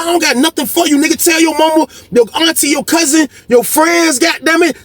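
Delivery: high, heated acted-out tirade; defiant rant. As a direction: pile up the list defiantly, pitch held way up.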